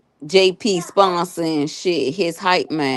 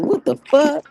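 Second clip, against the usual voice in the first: shocked; 10 semitones higher than usual. Retold or imitated. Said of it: "I was like".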